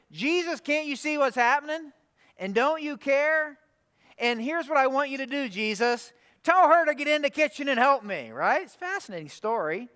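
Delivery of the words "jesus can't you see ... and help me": imitated higher-pitched, exasperated demand; fed-up complaint. Martha telling Jesus to send her sister in, fed up. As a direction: act out a sister at the end of her patience. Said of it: "after Martha can't take it anymore".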